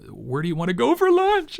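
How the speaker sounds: mock crying, rising high and loud; imitated breaking into tears. Husband asking wife about lunch, imitated breaking into tears. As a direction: let the question climb into a pretend wail. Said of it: "I'd start crying".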